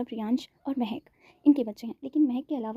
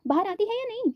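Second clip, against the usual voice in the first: high, sharp demand; angry demand; 9 semitones higher than usual. A narrator's reading. Mother to Anvi in the washroom, angry demand. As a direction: snap the ultimatum at the door. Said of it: "Nita जी गुस्से से".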